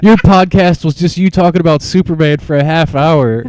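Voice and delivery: high, loud imitation of a critic; hammed-up retold complaint; mocking retelling. Podcaster quotes his online heckler, loud mocking complaint. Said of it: "then he was like".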